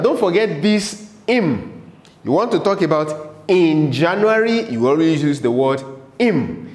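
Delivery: ordinary talk, nothing marked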